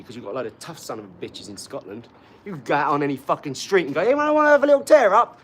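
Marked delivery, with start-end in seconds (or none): 4.0-5.4 shouting challenge. Pretend. Calling out to street for fight.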